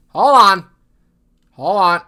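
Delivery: sudden loud call to stop; loud hold on. Real, not acted. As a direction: blurt it out loudly to halt. Stops the show mid-sentence.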